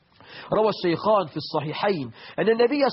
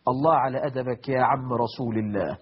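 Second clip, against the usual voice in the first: normal talking voice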